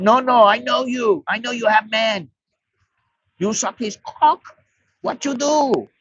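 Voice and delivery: high-pitched broken English, accusing; drunk foreigner's pidgin English. Retold or imitated. Drunk foreigner accusing the bar girl, in high-pitched pidgin English. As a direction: play a drunk foreigner jabbing at her in pidgin. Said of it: "And he's going".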